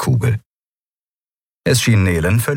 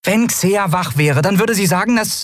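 high-pitched small voice; earnest Nelen; 13 semitones higher than usual. Acted. Nelen invoking sleeping Xea, high earnest voice. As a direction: appeal to them in a high voice. Nelen continues her appeal.

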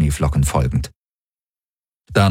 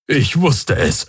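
slow, higher-pitched exclamation; blurted out; 6 semitones higher, slower than usual. A narrator's reading. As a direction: let it burst out, drawn out. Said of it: "stieß Hox aus".

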